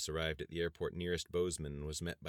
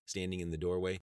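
ordinary delivery, nothing marked

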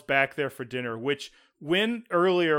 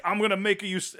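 mock Italian accent, raised pitch; bad Italian accent; 6 semitones higher than usual. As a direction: do a clumsy Italian restaurateur impression. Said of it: "I'm doing a really bad Italian accent".

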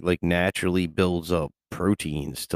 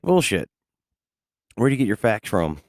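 retold retort; 4 semitones higher than usual. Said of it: "And I'm like".

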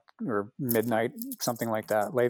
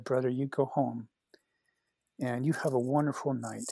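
tender blessing; 8 dB quieter than usual. Retold or imitated. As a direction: bless him quietly, a hint of the friend's tenderness. Blessing with hands on shoulders.

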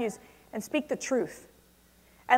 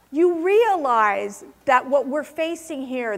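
normal talking voice

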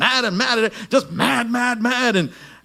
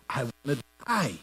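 quiet despair; 5 semitones lower, 7 dB quieter, faster than usual. Retold or imitated. Voicing depressed Elijah.

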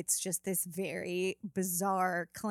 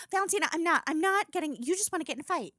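higher exasperated mom voice; frustrated mom; 7 semitones higher, faster than usual. Retold or imitated. Mom refusing to argue with daughter, exasperated. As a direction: cut the talk off, fed up. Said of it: "she'll just get really frustrated".

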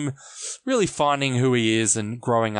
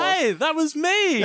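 high, drawn-out mock delight; gleeful recognition; slower than usual. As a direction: stretch it out high as if spotting yourself. Acts own nostalgic reaction.

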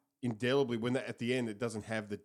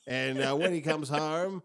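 mock gushing caller; 5 semitones higher, 4 dB louder than usual. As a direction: draw it out in hammed-up devotion. Mock caller answering dedication host.

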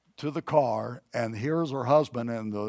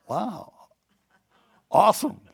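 mock triumphant wow; 8 semitones higher, 4 dB louder than usual. Thinks after casting out demon.

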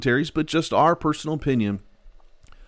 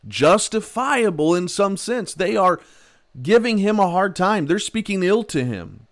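fervent preaching; 8 semitones higher, 4 dB louder than usual. Real, not acted. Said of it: "preacher heats up".

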